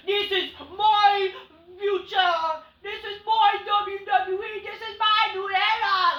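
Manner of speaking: high, slow yelling; hammed-up shouted promo; mock shouted debut. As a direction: bellow it like a hyped wrestler.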